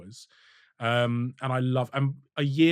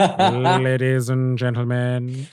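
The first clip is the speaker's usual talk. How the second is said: very slow, louder villain drawl; Alan Rickman; 7 dB louder, much slower than usual. Acted. Repeating a favourite film line for a laughing co-host, drawling villain impression. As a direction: draw every syllable right out, projecting. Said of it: "the way Alan Rickman says".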